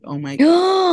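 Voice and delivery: very high, loud squeal of delight; retelling being floored by the gift; overwhelmed gasp. Retold or imitated. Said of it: "I was like".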